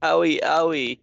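hammy fake whimpers; faking frailty. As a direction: moan owie like a faker. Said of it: "pretend to be sick".

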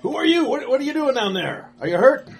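loud, high calls down the drain; guard shouting down to the hurt man, high and loud; alarmed guard shouting. Acted. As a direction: shout down into the sewer. Guard questions man below.

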